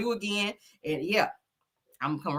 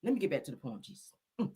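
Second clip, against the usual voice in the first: normal talking voice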